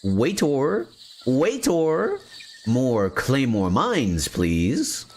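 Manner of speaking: high, drawn-out call for a waiter; mock restaurant customer. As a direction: summon the waiter with playful, sing-out pitch.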